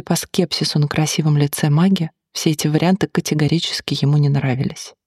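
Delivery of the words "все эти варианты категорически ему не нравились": usual speaking voice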